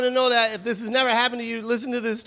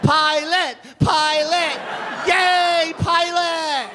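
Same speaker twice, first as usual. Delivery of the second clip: passengers cheering; 4 semitones higher, much slower than usual. Retold or imitated. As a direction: cheer the pilot like a crowd.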